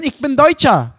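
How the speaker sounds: mock-indignant Berlin dialect. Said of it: "Guck mal, wie du redest".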